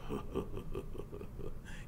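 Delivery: low breathy belly chuckle; belly laugh. Acted. Biker laughing at the kid. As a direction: laugh from deep in the gut. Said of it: "he starts to laugh, this fat sound way down in his big belly".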